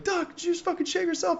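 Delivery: very high mock holler; imitated yell. Gunners yell at the medic, high mock yell. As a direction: holler the question thinly, way up high. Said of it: "the gunners are yelling at me".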